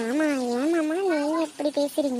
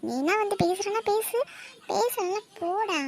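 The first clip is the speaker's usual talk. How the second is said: sulky scolding; 6 semitones higher than usual. Acted. Sulking wife scolds her husband.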